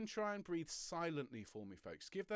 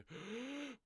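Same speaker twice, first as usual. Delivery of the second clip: sharp high gasp in; showing a gasp breath; demo gasp; 10 semitones higher than usual. Shown as a demonstration. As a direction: gasp in like shoulders shooting up. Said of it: "their shoulders go up like this".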